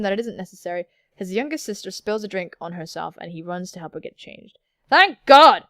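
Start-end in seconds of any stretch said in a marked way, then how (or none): 4.9-5.7 emphatic, booming exclamation; high, loud outburst; loud relief. Real, not acted. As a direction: burst out with relief.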